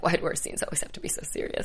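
hushed, whiny aside; mock whisper. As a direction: whisper it to a castmate. Retold complaint about laughing.

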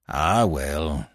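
put-on deep, soft old man's sigh of words; mollified old uncle. A narrator's reading. Old uncle after wiping his eyes, deep and resigned. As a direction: let it sink away low.

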